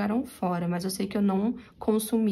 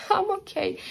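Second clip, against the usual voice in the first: much higher, squeaky teary I'm ok; mock tearful; 11 semitones higher than usual. Reassuring the stranger while crying, high and teary. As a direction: replay her own tearful voice, pitched up. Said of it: "Eu falei assim".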